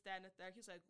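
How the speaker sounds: usual speaking voice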